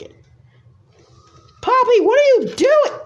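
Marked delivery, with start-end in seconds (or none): 1.6-3.0 very high, loud yell at Poppy; shrill yell. Acted. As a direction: shriek at her to stop. Character shrieks at Poppy.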